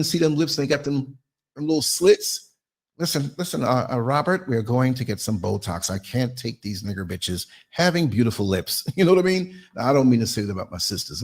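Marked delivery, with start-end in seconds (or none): 3.0-8.8 lightly voiced lower snooty wife; a hint of a spoiled woman demanding Botox. Wife telling husband Robert she wants Botox, lightly mocking voice. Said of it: "that's how they talk".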